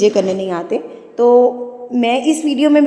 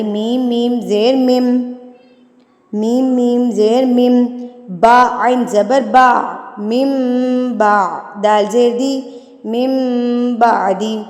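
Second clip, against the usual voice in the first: very slow, drawn-out letter by letter; slow spelling for children; much slower than usual. Shown as a demonstration. Teacher shows how young children should spell the word, very slowly. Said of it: "ان کو آپ یوں ہی پڑھائیں گے".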